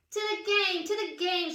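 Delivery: a light storybook shout to the game; mock shout. A narrator's reading. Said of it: "shouts Grandpa Bulldog".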